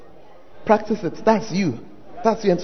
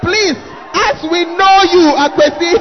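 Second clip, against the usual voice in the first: loud, high, drawn-out challenge; incredulous preacher's shout; exasperated shout; 6 semitones higher, 6 dB louder than usual. Acted. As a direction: call out the pretender at full voice. Preacher scolding the fake accent.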